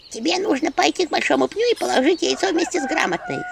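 high squeaky imp voice; squeak. Acted. Imp Anchutka squeaking instructions while running around. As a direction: squeak it out quickly. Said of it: "пищала Анчутка".

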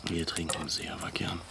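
soft, unvoiced whisper; whispered to the aide at night. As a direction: hush it close to him. Intimate night talk.

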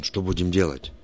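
ordinary delivery

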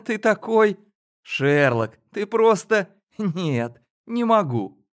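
laughing. Acted. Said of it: "Алина просто заливалась смехом".

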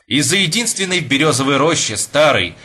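ordinary delivery

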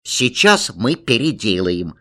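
ordinary delivery

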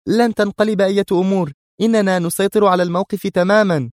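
very high, heated insistence; high-voiced stern rebuttal; heated sternness. A narrator's reading. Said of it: "قالت في صرامة محتدة".

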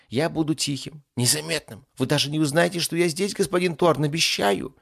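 high, eager-to-please servant voice; obedient demon. Acted. Demon promises master to stay unnoticed, eager.

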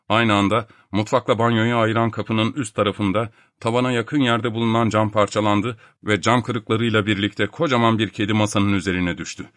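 usual speaking voice

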